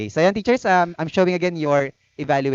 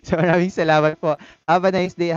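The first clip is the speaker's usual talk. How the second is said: higher, louder, through laughter; laughing while thanking; 5 semitones higher, 5 dB louder than usual. Real, not acted. Still laughing after the joke.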